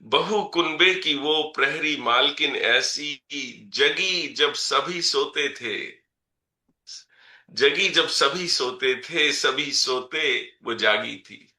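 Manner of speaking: measured, rhythmic reading of verse; poem recitation. Acted. Poet reciting a poem about his mother to the audience, measured recitation. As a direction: recite each line and let it land.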